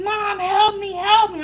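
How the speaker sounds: put-on high, loud, frantic child's cry for help; panicked kid's plea. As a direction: plead like a scared little girl. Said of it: "She was like".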